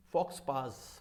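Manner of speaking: "फॉक्स पास" like fox pass, falling; common misreading. Shown as a demonstration. Teacher repeating the common mistake, wrong pronunciation. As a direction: sound every consonant in "फॉक्स पास". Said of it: "काफ़ी लोग फॉक्स पास पढ़ते हैं".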